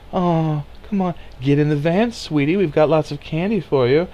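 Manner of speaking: put-on a sugary stranger coaxing a child; soft NPR voice. NPR host luring a child into the van, sweetly coaxing. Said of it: "whisper to me softly like an N P R".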